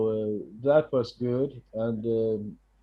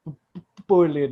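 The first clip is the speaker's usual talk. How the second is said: stuttering on the b; stuttering speech; 3 semitones higher, 3 dB louder, faster than usual. Real, not acted. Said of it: "I was stuttering a lot".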